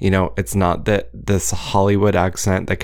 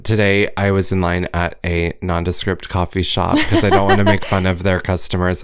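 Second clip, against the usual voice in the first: ordinary talk, nothing marked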